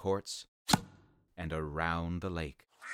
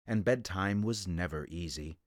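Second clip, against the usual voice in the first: ordinary delivery, nothing marked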